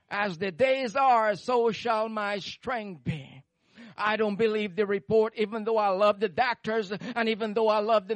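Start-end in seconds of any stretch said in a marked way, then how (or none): 0.1-3.4 slow emphatic repeat. Shown as a demonstration. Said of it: "verse repeated slowly".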